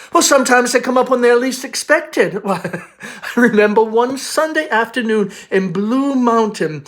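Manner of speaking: breezy Southern belle recalling her youth; airily. Acted. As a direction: float the memory up like gossip.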